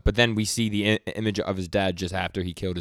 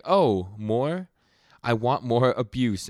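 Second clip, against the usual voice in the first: higher, louder, slow and relishing; hammed-up masochist villain begging for more; mock eager villain; 4 semitones higher, 4 dB louder, much slower than usual. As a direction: beg for more like a thrilled creep. Masochist villain voiced in recap.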